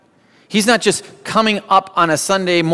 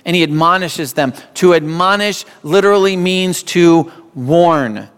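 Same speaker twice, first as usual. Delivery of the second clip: usual speaking voice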